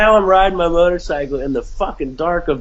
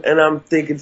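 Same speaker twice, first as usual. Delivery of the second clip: plain reading voice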